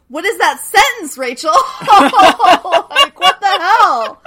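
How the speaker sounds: loud, high, incredulous through laughter; laughing outrage. Real, not acted. Reacting to what Matt did to him, loud laughing outrage. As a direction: cry out in appalled disbelief, cracking up. Mock outrage at the sad line.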